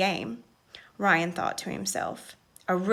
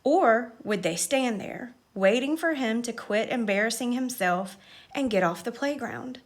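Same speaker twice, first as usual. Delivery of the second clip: ordinary delivery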